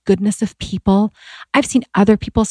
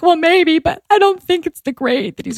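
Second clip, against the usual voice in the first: retold sobbing; 5 semitones higher than usual. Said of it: "I start sobbing".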